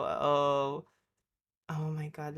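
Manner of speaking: ordinary delivery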